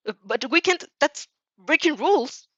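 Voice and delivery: high, loud, hesitant objection; acted anxious objection; worried protest. A narrator's reading. Shadow protests breaking the rules.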